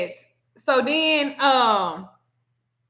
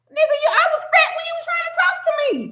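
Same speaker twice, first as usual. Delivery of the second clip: very high, rapid squeal; outraged comeback; much faster than usual. Acted. Answering rejected dude who called her fat, squealed outrage. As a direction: squeak it out fast in mock outrage.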